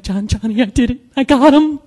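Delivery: nervously thrilled, acted out; nervous excitement. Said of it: "and I'm nervous".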